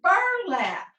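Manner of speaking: "burlap" said as one high, falling word; "burlap" with no gap between syllables; blended whole word. Shown as a demonstration.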